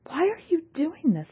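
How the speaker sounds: high anguished plea; crying out. A narrator's reading. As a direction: cry out in distress. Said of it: "Yalnis cried".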